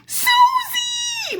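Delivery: a high, long joyful call of her name; excited shout-out. Real, not acted. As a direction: hail her like a dear sister. Calling out a viewer's name.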